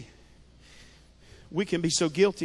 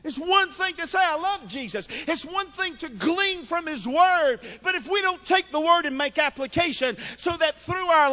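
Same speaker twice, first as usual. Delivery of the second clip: raised-pitch pulpit proclamation; impassioned preaching; 8 semitones higher than usual. Acted. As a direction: hold out key words like a preacher. Preaches at full force.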